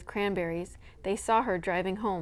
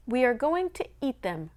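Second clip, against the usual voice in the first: dauntless; 5 semitones higher than usual. Acted. Mother defying her bad luck, dauntless declaration. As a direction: announce it with chin up. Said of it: "lifting a dauntless head".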